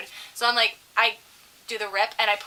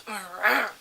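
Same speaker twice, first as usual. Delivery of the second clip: burly man's grunts. Retold or imitated. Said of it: "burly man. Doesn't say much. He goes".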